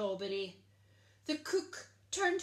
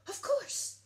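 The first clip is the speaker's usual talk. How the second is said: much higher realisation; gasped; 14 semitones higher, 6 dB louder than usual. A narrator's reading. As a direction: catch the idea on a gasp. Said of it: "gasped the cook".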